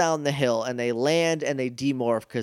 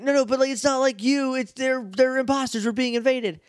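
higher urgent pleading; insistent Justin; 10 semitones higher than usual. Retold or imitated. Justin insisting to the Rangers, higher and urgent. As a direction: press your point, pitched up. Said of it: "he's like".

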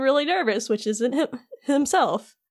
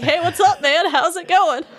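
higher, louder breezy hello; breezy greeting; 6 semitones higher, 4 dB louder than usual. Acted. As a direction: stroll in and call out cheerfully. Heroes barge in casually.

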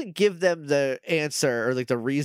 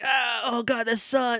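mock pained yell; 8 semitones higher than usual. Vampire Doggy hit by sunlight.

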